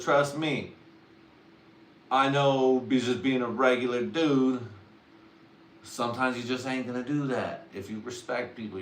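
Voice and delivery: usual speaking voice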